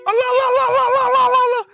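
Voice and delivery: high, loud frantic chanting of Allah; panicked repeated cries. Real, not acted. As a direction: keep crying out in panic. Fleeing big heads in game.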